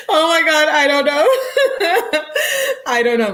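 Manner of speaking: high giggly laughter through the words; real laugh breaking up the words. Real, not acted. Reading the boyfriend's texts to viewers, laughing hard.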